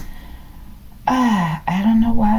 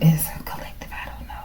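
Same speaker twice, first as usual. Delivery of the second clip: whisper; 5 semitones lower, 9 dB quieter, faster than usual. Real, not acted. Puzzled aside to herself.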